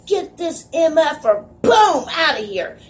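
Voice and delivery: high-pitched mock outburst; imitated angry order. Retold doctor kicking away stretcher.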